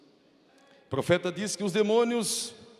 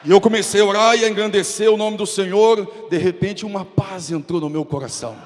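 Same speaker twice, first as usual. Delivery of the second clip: high and loud preaching, easing off toward the end; fiery preaching; 6 semitones higher, 11 dB louder than usual. Acted. Pastor telling the congregation how the evil spirit left, fiery preaching. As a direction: preach it with fire, then let the peace settle.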